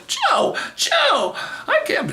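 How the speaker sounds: very high imitated squeal of horror; squeaky, hammed-up shock; mock horrified. Sales rep hearing the graphic story, mock-horrified squeal. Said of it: "she's acting like she's all horrified".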